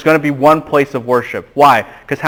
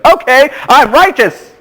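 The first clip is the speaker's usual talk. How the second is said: smug Pharisee; 10 semitones higher than usual. Retold or imitated. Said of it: "the Pharisee's like".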